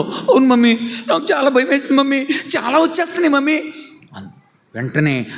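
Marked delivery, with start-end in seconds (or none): 0.0-3.6 put-on high, fast trembling child voice; a terrified girl clinging to mummy; scared child. Daughter telling mummy she is very scared.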